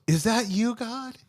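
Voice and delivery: high, pleading little question; a dangling hiker squeaks up hopefully; high, small and unsure. Acted. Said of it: "And he goes".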